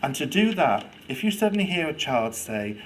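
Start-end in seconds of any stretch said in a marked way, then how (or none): none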